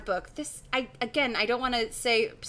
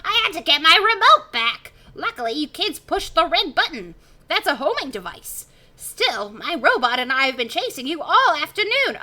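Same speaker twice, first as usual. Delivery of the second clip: raised, loud cartoon professor voice; Professor Shock; 7 semitones higher, 8 dB louder than usual. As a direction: keep snapping as the worked-up professor. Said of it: "Professor Shock snaps".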